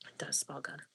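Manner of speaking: soft whispered aside. Real, not acted. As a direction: breathe it quietly. Whispered aside while eating.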